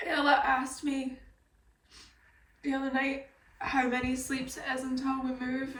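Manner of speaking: ordinary talk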